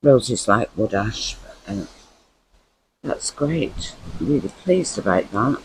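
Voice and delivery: ordinary delivery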